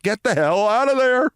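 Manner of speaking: high, pressing cry of alarm to himself; urgent warning. Pretend. Older man warning his fourteen-year-old self, high urgent cry. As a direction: warn the kid to get out, now.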